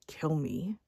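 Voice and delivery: a flat, dead whisper. Retold or imitated. Child whispering a plea to his mother. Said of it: "that angry, dead look and said".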